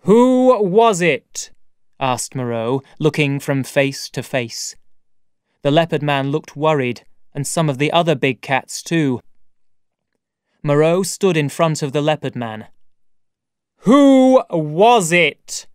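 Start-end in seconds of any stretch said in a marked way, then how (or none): 0.1-1.5 loud, raised, slow question; drawn-out accusing demand. Acted. Moreau questions the crowd. 13.8-15.7 loud, high, very drawn-out demand; terrible, menacing interrogation. Acted. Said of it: "in a terrible voice".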